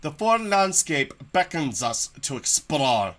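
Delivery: loud, grand adventure narrator; grand narration. Acted. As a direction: proclaim it like an explorer's log. Reads game text in narrator voice.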